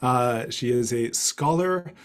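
ordinary talk, nothing marked

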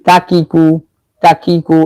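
কাকিকু with light front "k" on each syllable; plain "kaa-kee-koo", syllable by syllable; natural light k sounds. Shown as a demonstration. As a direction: recite কাকিকু evenly, no weight in the throat. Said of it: "বলি এরকম".

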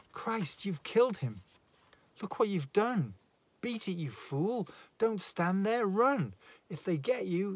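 high, loud frantic urging; woman begging her lover to flee; panicked. Acted. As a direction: push him out the door in terror. Panicked woman urges killer flee.

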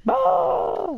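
high startled cry of Oh!; startled shout. Acted. Caught at the bins, a high startled shout. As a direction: jump out of your skin.

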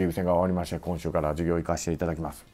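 low, quiet, even polite student recital; 教授に申し出る学生. Retold or imitated. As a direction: politely report to the professor in a subdued voice. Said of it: "その教授に". (教授に低く抑えた声で丁寧に申告)

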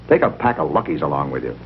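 ordinary delivery

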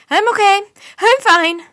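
high, loud nervous shout. A narrator's reading. Mike startled by thunder, loud nervous shout. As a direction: shout it to calm yourself down. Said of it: "Mike shouted".

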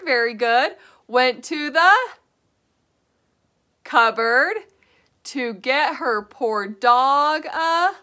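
teacher leading a class chant; call and response. Shown as a demonstration. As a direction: pause so kids can fill in. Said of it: "Can you guys say it with me?".

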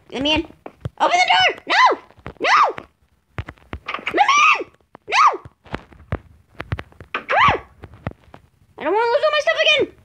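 very high frantic screams to be let in; panicked shouting. Real, not acted. Scared gamer begging to be let in before monsters get him, high panicked shout. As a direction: scream desperately at the door.

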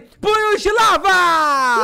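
drawn-out high shout of the move name; slow, booming superhero yell; attack battle cry. Pretend. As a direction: bellow the power like a cartoon hero. Yelling his attack name.

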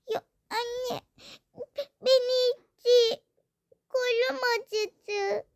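high, slow tearful complaint; crying child. Acted. Daughter crying to her mother about her arm. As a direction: cry out the hurt.

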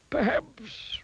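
put-on high, slow old wizard; a high, doddering wizard offering help. A narrator's reading. Wizard offering to help the miser.

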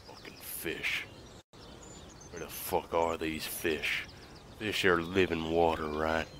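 low, quiet mumbled impression of a blank-faced angler; soft, low, deadpan inner monologue; emotionless. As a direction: voice his thoughts with no emotion. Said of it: "He's showing no emotion".

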